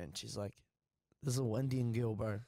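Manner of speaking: normal talking voice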